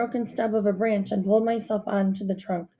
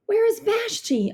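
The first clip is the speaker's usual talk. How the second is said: much higher calling question; 12 semitones higher than usual. A narrator's reading. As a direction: call up to the bear. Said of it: "I called to her".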